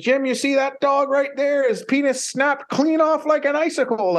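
high-pitched earnest musher voice warning Jim; squeaky, wide-eyed warning about the dogs; imitating the musher. Musher warns Jim about frozen dogs, high mock voice. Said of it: "He said".

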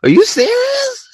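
very high, shouted disbelief with a crying edge; squealed "are you serious"; mock disbelief. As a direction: yelp it in high-pitched disbelief. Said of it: "And I'm just like".

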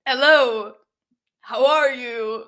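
loud, bright mock hello, slowing on how are you; awkward excited greeting. Reader mimicking an awkward meeting, loud and slow. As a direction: act out two people surprised to bump into each other. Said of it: "they're like".

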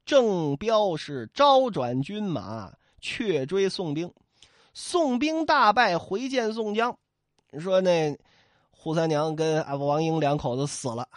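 usual speaking voice

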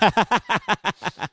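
telling it through a laugh. Real, not acted.

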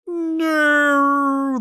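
long, high-pitched wailing "no"; funny cry. Retold or imitated. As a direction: imitate a comically drawn-out cry. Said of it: "like, cry that he does".

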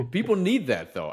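high burst of laughter; host laughing at the guest's story. Real, not acted. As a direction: crack up, then speak through it.

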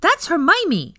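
put-on high, loud eager little boy; an eager boy answering before anyone else; excited child. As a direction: blurt it out bouncing with excitement. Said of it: "said Teddy excitedly".

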